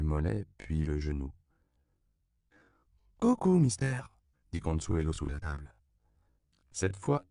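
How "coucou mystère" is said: put-on high, loud little girl's call; playful child. Consuelo greets mister from under the table, playful child voice. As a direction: sing out a cheeky peekaboo greeting.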